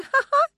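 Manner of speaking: very high, loud short ha-ha; tipsy laugh. Acted. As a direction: cackle at your own joke. Tipsy woman laughs at own joke.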